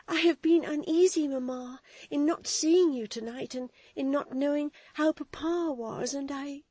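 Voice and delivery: put-on high, loud girlish worried voice; anxious girl. Florence telling her stepmother she was uneasy. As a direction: pour out her worry to her mother.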